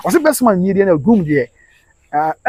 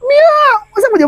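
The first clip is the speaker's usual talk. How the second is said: excited high cry; 4 dB louder than usual. Real, not acted. Excited exclamation.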